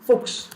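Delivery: "Fuchs" said as "fooks"; "Fuchs" as a standalone German example word; chs as ks. Shown as a demonstration. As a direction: end "Fuchs" on a crisp ks. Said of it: "going to be pronounced as x".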